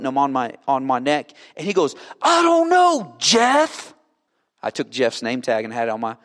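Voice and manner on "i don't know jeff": acted exasperated outburst, high and loud; high-pitched yell of frustration; frustrated shout. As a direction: blurt it out at the top of your voice. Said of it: "he gets frustrated".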